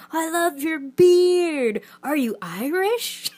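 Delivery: high, slow, delighted gushing; slow, high mock-delighted gush at a stranger's beard; gushing fan. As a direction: imitate an excited stranger gushing over the beard. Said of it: "people kept coming up to him and being like".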